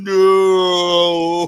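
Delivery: loud, high, hugely drawn-out NO; slow-motion no. Acted. Co-host mimicking the dad's disaster, slow-motion no. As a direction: bellow it in exaggerated slow motion. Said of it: "It's like in slow motion".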